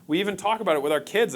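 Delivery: ordinary talk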